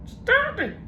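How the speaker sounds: very high parrot echo; mock him back like a parrot; parrot mimicking.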